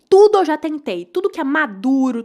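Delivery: a loud frustrated burst; exasperated outburst. Real, not acted. To viewers, loud exasperated burst. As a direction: throw it out fed up. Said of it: "exasperated outburst".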